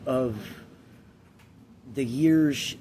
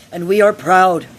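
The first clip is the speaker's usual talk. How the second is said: higher and louder, a proud mother praising her son; mom's proud speech; 7 semitones higher, 10 dB louder than usual. Retold or imitated. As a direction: announce it like a proud parent handing over the job. Mom voiced in retold parable.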